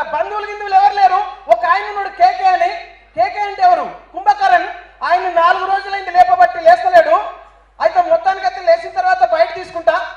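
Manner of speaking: high, loud rustic informant; loud local witness. Acted. Local telling the reporter about Kumbhakarna, high and loud. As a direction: call out answers to the reporter.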